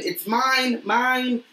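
high urgent it's mine; urgent claim. Acted. Harry urgently claims map.

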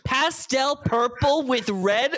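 slow, climbing high disbelief; appalled, voice rising sharply; incredulous. Retold or imitated. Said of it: "I was like".

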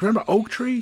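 hurt that nobody remembers; high, loud outraged question; pissed off. Acted. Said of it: "He gets all pissed".

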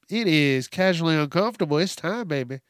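normal talking voice

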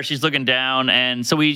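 ordinary delivery

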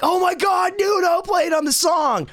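very high-pitched fan squeal; excited outburst. Retold or imitated. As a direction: reenact a starstruck shout, stretching it out. Retells thrilled reaction on his birthday.

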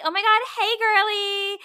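high-pitched, sugary fake-friendly girl; mock a popular girl talking down to someone; condescending mean girl. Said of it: "They sound so condescending".

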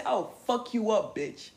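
loud, tough street threat. Retold or imitated. Tough threat that makes a bully back up. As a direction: bark it to make them back off. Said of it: "But if you say".

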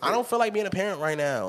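high, airy mock of a careless mother. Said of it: "the mother make it sound like a puppy".

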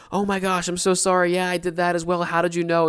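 high, startled and apologetic, the words tumbling out; a young man stunned that the priest knows his sin; shocked apology. Retold or imitated. Young man to the priest: startled, high apology.

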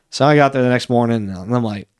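ordinary talk

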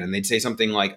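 normal talking voice

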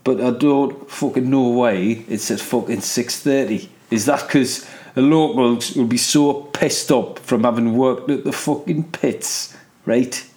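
put-on Geordie local; Geordie accent. Joking to the audience about boozy Newcastle pit workers, in a Geordie accent. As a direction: slip into a broad Geordie voice. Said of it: "I fucking love the Geordie accent".

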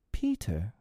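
softly attempted firmness; trying to be firm. A narrator's reading. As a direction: try to hold steady. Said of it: "trying to speak firmly".

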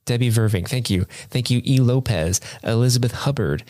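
ordinary talk, nothing marked

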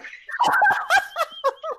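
high, loud burst of laughter; real high-pitched cackling laugh. Real, not acted. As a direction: crack up loudly. Laughs at Ice Cream Bunny.

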